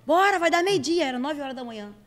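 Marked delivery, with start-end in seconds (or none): 0.0-1.1 lightly voiced mom, higher and louder, hurrying the kid out of bed; a hint of a bossy mother, higher and louder. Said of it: "Muito minha mãe".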